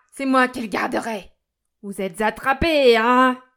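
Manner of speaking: loud gloating, building at the end; triumphant shout. A narrator's reading. Delirious miser gloats over saved banknote, shouting. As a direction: crow over them at full voice. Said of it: "cria-t-il".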